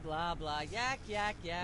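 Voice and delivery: the rant trailing off very soft into blah blah; a soft droning ramble; fading rant. Acted. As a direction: mumble the filler words far under the voice. Host rant fades into blah.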